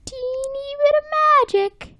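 put-on squeaky-high teeny, then slow drawn-out promise; mystical magician. Magician promising SpongeBob magic, mystical. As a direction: pitch up high, then stretch it out grandly.

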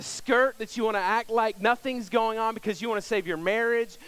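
high, loud pulpit appeal pressing the question; fervent preaching. Acted. Preacher challenging his congregation this morning, loud impassioned appeal. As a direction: call the congregation to repent, full force.

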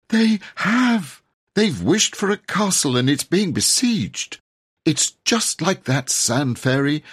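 high, loud, urgent dismay; fervent. Acted. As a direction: pour it out in agitated disbelief. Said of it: "said Robert fervently".

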